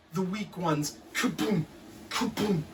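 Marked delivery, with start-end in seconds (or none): none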